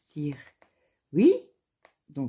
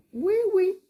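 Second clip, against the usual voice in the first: much higher and louder, brushing it off; mock high insistence; 6 dB louder than usual. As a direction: squeak out that it's nothing, all fake bravado. Insisting she's sure.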